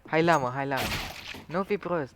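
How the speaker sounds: loud call to a teammate; urgent calling out. Real, not acted. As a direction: raise your voice, urging them over. Calling teammate during a game round. (chemare insistentă, cu voce tare)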